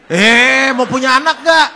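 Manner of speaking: loud, high mock rebuke; acted scolding shout. Doctor to patient who doubts the diet, scolding shout. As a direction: cut in with a big high shout, imitating the doctor.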